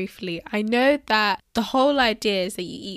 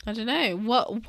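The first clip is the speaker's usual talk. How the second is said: ordinary delivery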